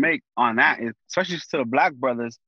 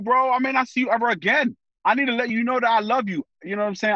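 normal talking voice

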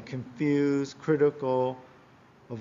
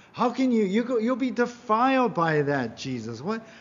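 imitated outraged complaint, high and loud; complaining; 7 semitones higher, 3 dB louder than usual. Crowd challenging Jesus over being defiled, indignant complaint. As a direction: protest like an appalled onlooker. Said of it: "And so they complain, they grumble".

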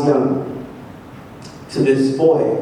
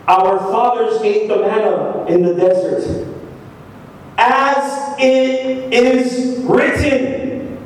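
deliberate reading, drawn right out at the end; slow reading; slower than usual. Shown as a demonstration. Preacher has them listen to the word, slow reading. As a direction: stretch "as it is written" very slowly. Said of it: "Let's read it slowly".

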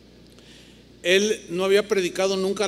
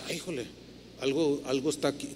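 hushed dismay; 5 semitones lower, 10 dB quieter, faster than usual. Retold or imitated. Shocked aside.

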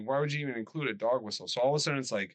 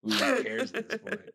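mock ghost drawl; 12 semitones higher, 3 dB louder than usual. Ghost voice, slowed.

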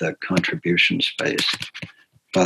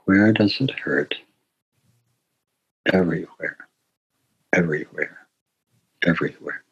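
soft, fading repetition; quiet, contemplative echo of the poem; hushed reflection; 2 semitones lower, 5 dB quieter than usual. Real, not acted. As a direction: let the words sink in quietly. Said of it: "be in silence with that".

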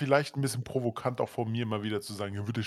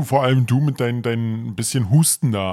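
louder, mocking emphasis on du; loud mock teasing; 8 dB louder than usual. Real, not acted. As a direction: rib him loudly about the cough. Teasing co-host about cough.

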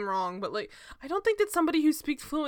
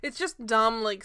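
usual speaking voice

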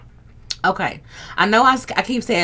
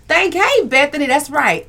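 high, loud burst of agreement; excited shout-out; 7 semitones higher, 6 dB louder than usual. Real, not acted. Greeting viewer Bethany in the chat, high and loud. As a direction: cheer for a viewer's answer.

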